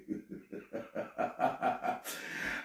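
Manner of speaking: soft, breathy run of chuckles; laughing. Real, not acted. Host laughs at own dig at Americans, soft chuckle. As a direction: chuckle quietly, building as it goes.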